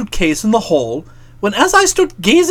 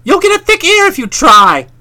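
mock threat; 9 semitones higher, 5 dB louder than usual. A narrator's reading. Bertie threatening Edwin.

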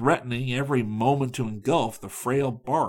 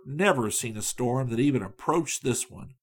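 ordinary delivery, nothing marked

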